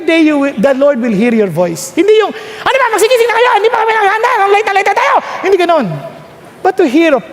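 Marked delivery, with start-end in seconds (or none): 2.3-5.1 higher, hurried mock scolding; high-pitched mock fluster; flustered rushing parent. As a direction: hustle the family awake in a panic. Acted panicked parent waking family.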